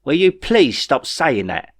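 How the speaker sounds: slow, exasperated retort. A narrator's reading. Jeff snaps back at whining Ray, slow and irritated. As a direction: push back, fed up. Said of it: "retorted Jeff".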